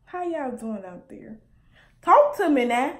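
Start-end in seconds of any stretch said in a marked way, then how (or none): 2.0-3.0 catchphrase. Real, not acted. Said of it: "Why do I always say that?".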